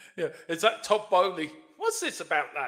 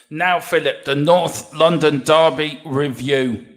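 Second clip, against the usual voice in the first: loud, slow, emphatic presenter intro; segment announcement; 8 dB louder, slower than usual. Real, not acted. Host introduces derby review to co-host, announcing. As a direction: announce it loudly, drawing out the title.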